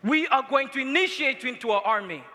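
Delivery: loud, high commander's yell, imitated; retold yelling. Rebel commander to lines of captured children, imitated yelling. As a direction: yell it out like a commander addressing captives. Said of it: "he kept yelling".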